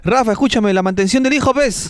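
high-pitched nagging call, not fully shouted; mock yell, voice pushed up high; retold woman's yell. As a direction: imitate a neighbour calling out a demand. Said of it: "una señora grita".

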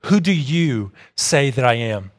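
low, soft and deliberate pointed question; grave, hushed quote of a searching question. Retold or imitated. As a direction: put the question quietly and weightily, voice dropped. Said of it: "asks the pointed and much more important question".

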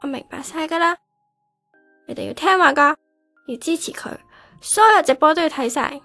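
loud, forceful address to a crowd; hurt outburst. Acted. Replaced girl telling her fans to support her rival, hurt. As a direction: call out orders, hurt underneath.

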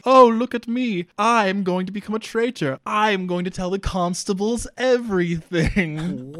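high, loud mock announcement, slowing at the end; gleeful over-the-top confession; hammed-up villain brag. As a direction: ham up a traitor's proud declaration. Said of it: "he's like".

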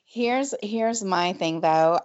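usual speaking voice

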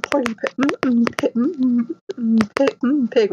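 stammering on Porky Pig; humming blocks before Pig; playful stutter. Shown as a demonstration. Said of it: "going back to Porky Pig".

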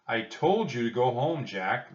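put-on commanding reprimand to a disobedient child; stern. A narrator's reading. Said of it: "the captain said sternly".

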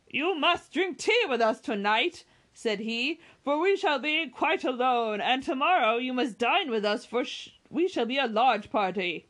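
a bluff squire pressing his invitation; jovial host. A narrator's reading. Sir John invites them heartily.

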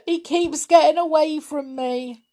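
put-on tearful boy wailing about his toad. A narrator's reading. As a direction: wail it like a lost child. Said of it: "he wailed".

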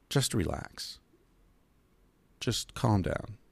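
soft, slow, soothing; soothing advice. Acted. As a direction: ease someone down gently. Voicing his chill-out advice.

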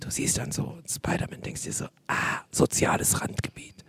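soft conspiratorial whisper. Real, not acted. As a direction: whisper it like a secret. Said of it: "es gibt gar keinen Grund zu flüstern".

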